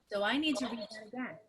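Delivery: ordinary delivery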